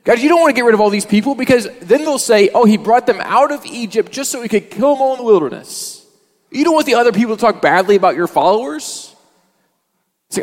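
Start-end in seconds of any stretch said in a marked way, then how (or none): 0.0-7.6 raised, louder urgent argument; pleading Moses. Retold or imitated. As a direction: reason hard with God. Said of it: "He says".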